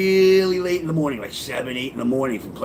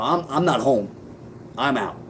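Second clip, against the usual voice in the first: ordinary talk, nothing marked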